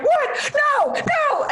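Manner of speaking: high-pitched wailing disbelief, hammed up; mock crying. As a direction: imitate guy friends bursting into distraught cries. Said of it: "start crying".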